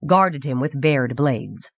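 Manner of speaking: ordinary delivery, nothing marked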